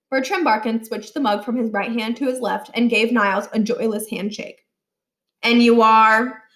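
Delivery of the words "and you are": loud, slow, unimpressed question; a stern principal sizing up a boy; cold principal. A narrator's reading. Principal coldly asking the boy's name, slow and loud.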